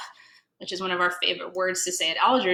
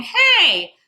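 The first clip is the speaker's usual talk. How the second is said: much higher, mocking voice; scoffing pickles; 9 semitones higher, 3 dB louder than usual. Pickles mocking the gefiltes at the deli, high. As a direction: sneer like a rude pickle. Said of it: "the pickles are saying".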